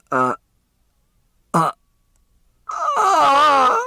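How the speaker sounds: strangled choking cries, the last one high and loud; choking gasps. Acted. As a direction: choke out each uh as the air runs out. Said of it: "red-faced and gasping".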